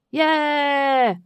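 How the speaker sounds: high loud cheer; crowd cheer. A narrator's reading. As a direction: roar like a crowd. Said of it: "chanted the crowd".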